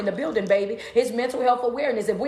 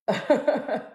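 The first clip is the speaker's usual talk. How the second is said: short amused chuckle; brief laugh to oneself. Real, not acted. Coach amused by attention seekers, laughing.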